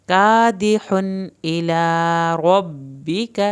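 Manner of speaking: slow, drawn-out Quranic recitation; Arabic recitation demo, every letter drawn out. Shown as a demonstration. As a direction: recite each sound carefully and slowly. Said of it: "tajweed teacher demonstrates recitation".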